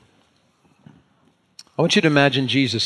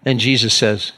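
normal talking voice

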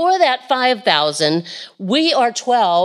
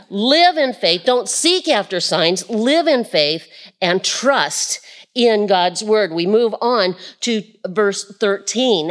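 normal talking voice

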